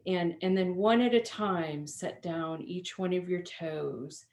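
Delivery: normal talking voice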